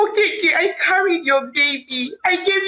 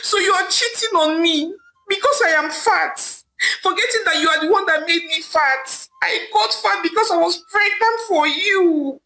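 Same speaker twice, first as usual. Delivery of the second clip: higher, tearful pleading; crying; 7 semitones higher than usual. Acted. Wife confronting husband Okeke, crying and pleading. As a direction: sob out the accusation, pitched high. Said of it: "Amaka said, crying".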